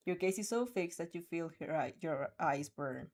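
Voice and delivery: ordinary delivery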